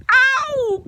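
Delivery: high, loud "ow"; loud, high yelp of pain; cry of pain. Acted. Pooh being pulled by Rabbit, pained cry. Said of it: "cried Pooh".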